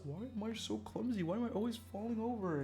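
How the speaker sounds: high, plaintive self-questioning; mock whining. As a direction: whine it as a baffled complaint. Retelling own baffled complaint.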